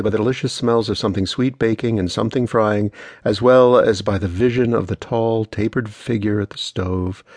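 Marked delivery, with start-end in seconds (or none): none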